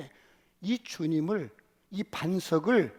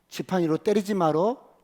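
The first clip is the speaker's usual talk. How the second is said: louder insistent command; firm loud warning; 10 dB louder than usual. Acted. God warning Moses, loud and insistent. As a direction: hit 때리지 hard, rising.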